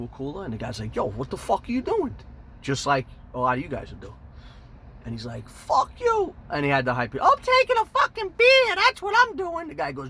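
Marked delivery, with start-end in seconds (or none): 1.0-2.2 mock confrontation. Cooler owner confronting Rhoda. 5.5-6.3 put-on very high, loud drunken shriek; drunk yell. Rhoda yelling at the cooler owner, shrieking high. 7.1-9.7 put-on very high, loud drunken shriek; drunk yell. Rhoda yelling at the cooler owner, shrieking high.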